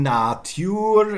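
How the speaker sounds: "Natur" as NAA-tyoor; slow, loud "Natur" with long a; long first vowel. Shown as a demonstration. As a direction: say "Natur" with y-glide. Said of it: "it must have been long".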